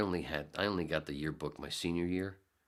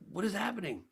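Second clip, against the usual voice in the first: much higher, panicked question; alarmed disbelief; 4 dB louder than usual. Retold or imitated. Mob closing in, a high alarmed question. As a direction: cry out in shocked confusion. Horde of people coming at him.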